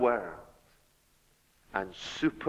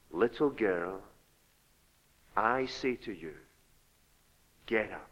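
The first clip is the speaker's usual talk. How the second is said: tender; 5 semitones lower, 8 dB quieter than usual. A narrator's reading. Jesus to the dead girl, tender and soft. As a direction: call a dead child back as gently as waking her. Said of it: "And tenderly".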